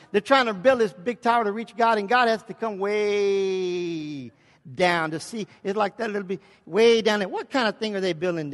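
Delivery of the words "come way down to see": the word way drawn out very slow with a shout; stretched way. Acted. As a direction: stretch way to show how far down. Mocking God stooping to tiny tower.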